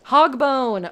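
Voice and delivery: loud delighted announcement; excited. Real, not acted. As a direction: present it like a star. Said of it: "Oh my god, star".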